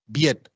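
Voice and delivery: BIET with an 'ie' glide; Creole diphthong. Shown as a demonstration. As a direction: say BIET as 'byet'. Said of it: "todas esas en criollo sistemáticamente".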